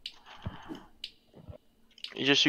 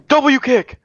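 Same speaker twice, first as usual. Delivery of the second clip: high, punchy game shout; excited, high-pitched callout; 11 semitones higher, 4 dB louder than usual. Real, not acted. Calling out a play in game.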